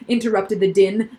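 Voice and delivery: ordinary delivery, nothing marked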